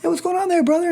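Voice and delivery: high, bright, oversold hello; slick salesman greeting. Retold or imitated. Evangelist playing salesman to a stranger, high oversold greeting. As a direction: greet him like a smooth salesman working a mark.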